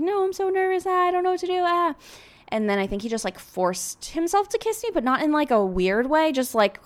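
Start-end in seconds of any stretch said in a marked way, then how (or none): 0.0-1.9 flustered, panicked high voice; nervous panic. Retold or imitated. Retold panicking before first kiss.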